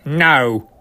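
snapped. A narrator's reading. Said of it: "the Cove snapped".

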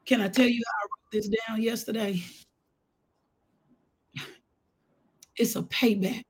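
hushed, breathy whisper; whispered aside. Shown as a demonstration. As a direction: lean in and whisper it. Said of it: "whispered then repeated aloud".

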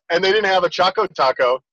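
ordinary talk

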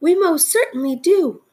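high, piped-up little voice; tiny character piping up. A narrator's reading. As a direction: pipe it up very high, eagerly. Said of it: "piped the voice".

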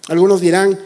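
usual speaking voice

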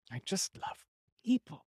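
quoting him. Retold or imitated. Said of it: "you actually said the exact same thing".

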